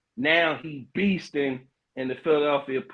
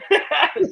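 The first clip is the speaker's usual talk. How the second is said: high squealing laugh; 5 dB louder than usual. Real, not acted. Host laughing at his own left-handed boast, squealing laugh.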